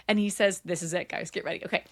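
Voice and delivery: ordinary talk, nothing marked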